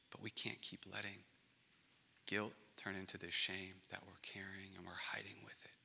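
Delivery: soft whisper, no voice. Acted. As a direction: lean in and whisper it. Quiet confession about hidden shame.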